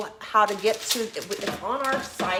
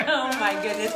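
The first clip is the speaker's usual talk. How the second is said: laughing; 4 dB louder than usual. Real, not acted. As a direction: still giggling. Laughing after stumble.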